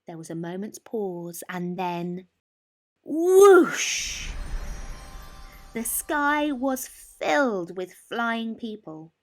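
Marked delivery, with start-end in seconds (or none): none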